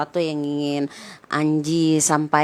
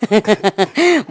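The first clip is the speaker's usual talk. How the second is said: short laugh; 5 semitones higher, 5 dB louder than usual. Real, not acted. Host laughs before joking.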